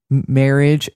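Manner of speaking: usual speaking voice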